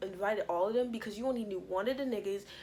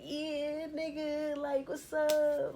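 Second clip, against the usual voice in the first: high, drawn-out casual hey; excited greeting; 8 semitones higher, much slower than usual. Retold or imitated. Chanel greeting the boys downstairs, high excited hello. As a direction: greet your boys like you're hyped.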